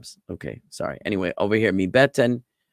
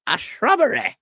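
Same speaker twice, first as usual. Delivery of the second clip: very high-pitched, absurd knight demand; Knight who says Ni; 4 dB louder than usual. Acted. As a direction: pipe it up high like a Python knight. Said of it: "Thank you, [name]".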